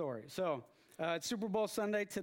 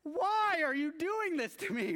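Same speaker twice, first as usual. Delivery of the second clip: much higher, louder, laughing anguish; mock-tragic cry through a laugh; laughing despair; 9 semitones higher, 5 dB louder than usual. Fan to coworker, a laughing mock-anguished cry. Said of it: "this look that I had".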